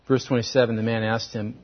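normal talking voice

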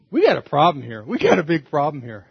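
hammed-up husband at the bottom of his dig; mock worried husband. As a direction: play the husband staring into his giant pit, half-joking alarm. Husband stuck in huge hole.

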